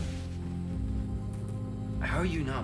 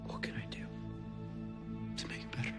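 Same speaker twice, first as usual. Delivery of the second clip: whispered plea; 11 dB quieter, faster than usual. Acted. Pleading after a confession.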